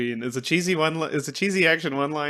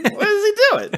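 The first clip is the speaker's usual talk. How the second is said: much higher, squeaky mock disbelief; 7 dB louder than usual. Repeats it in a high voice.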